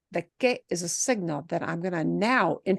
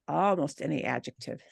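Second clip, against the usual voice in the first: quiet repeat; 6 semitones lower, 6 dB quieter than usual. Shown as a demonstration. Teacher quietly repeats "almost any adjective" for the class. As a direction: lower and softer, stressing "almost". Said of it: "notice that".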